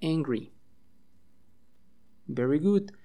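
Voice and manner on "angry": "angry" said as a clear model word with falling tune; "angry" as a standalone English vocabulary word; model pronunciation. Shown as a demonstration. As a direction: say "angry" for learners to repeat, voice falling at the end. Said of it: "repeat after me".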